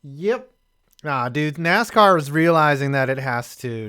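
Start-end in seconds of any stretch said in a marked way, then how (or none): 0.0-0.5 hammed-up good ol' boy scoffing at Europeans. Mocking NASCAR fans' view.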